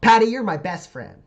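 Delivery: put-on high, loud little girl gushing; child's loud adoring squeal; excited child. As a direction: gush like a kid hugging her hero. Little girl hugs hero's leg.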